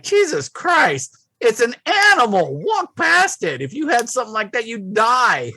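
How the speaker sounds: high-pitched exasperated rant; exasperated outburst. Retold or imitated. Man retelling his rant at giggling passers-by, exasperated. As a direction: exclaim it high, incredulous and fed up.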